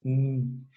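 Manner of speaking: "N" as a held hum, not the letter name; sound of N. Shown as a demonstration. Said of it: "estoy haciendo el sonido de N".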